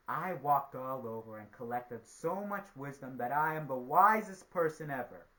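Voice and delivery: loud, boastful proclamation; crying out. A narrator's reading. Spider at the treetop boasting of his wisdom, crying out. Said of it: "he cried out".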